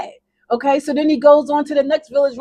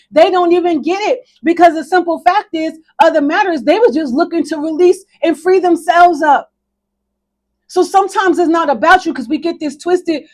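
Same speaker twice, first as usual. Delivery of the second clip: raised, driving preacher delivery; fervent preaching; 3 semitones higher, 5 dB louder than usual. Real, not acted. Preacher telling congregation to release others' burdens, fervent preaching. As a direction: preach it hard, urging the congregation on. Preaches the lesson at full force.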